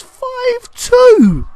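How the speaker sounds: high, loud cry of amazement; big, high-pitched exclamation; amazed shout. Real, not acted. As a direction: shout the scoreline in sheer astonishment. Marvels at a real scoreline.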